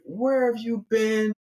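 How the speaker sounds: high-pitched, scolding interrogation; irate girlfriend. Acted. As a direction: grill him in a high, indignant voice. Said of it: "his girlfriend is irate".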